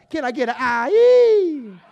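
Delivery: long, drawn-out loud call for an aye; rally the room, slow and loud, like a preacher; hype call to the crowd. Real, not acted. Chef calling to the studio audience: loud, stretched hype call.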